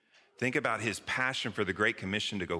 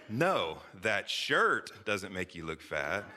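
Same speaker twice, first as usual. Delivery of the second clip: slow, loaded emphasis on "shirt"; stress on SHIRT; much slower than usual. Shown as a demonstration. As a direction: lean hard on "shirt", as if it is only the shirt. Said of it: "emphasis matters".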